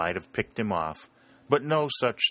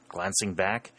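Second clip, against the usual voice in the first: usual speaking voice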